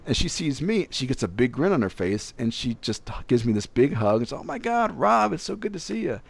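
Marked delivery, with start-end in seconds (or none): 4.3-6.2 high, gushing hello, imitating an excited little woman; excited greeting. Imitating tiny Megan gushing at Rob on arrival, high and excited. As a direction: gush it like she's thrilled to see you.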